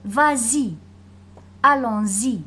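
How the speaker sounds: « Vas-y », « Allons-y » avec liaison z devant y. Shown as a demonstration. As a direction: fais sonner zi dans « Allons-y ». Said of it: "la liaison entre le S et le Y". (liaison : « Allons-y » se dit allon-zi)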